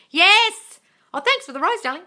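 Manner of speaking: sudden high, loud yes; bright cheer of agreement; excited yes. Real, not acted. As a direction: burst out happily. Excited reply to viewer comment.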